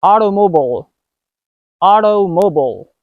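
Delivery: Automobile with the T as a quick D; American flap T. Shown as a demonstration. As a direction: tap the T in Automobile. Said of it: "try some words using this".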